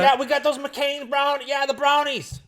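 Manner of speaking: mocking the mom hollering about brownies; mom calling again. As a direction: keep the falsetto mom caricature going. Continuing the mom impression.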